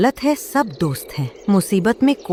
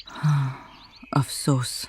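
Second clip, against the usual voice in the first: quiet regret; 6 semitones lower, 8 dB quieter, slower than usual. Acted. Crow regrets ignoring Rano.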